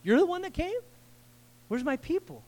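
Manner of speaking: Jesus amazed the outsider came back; surprised Jesus asking. Retold or imitated. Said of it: "He's just surprised".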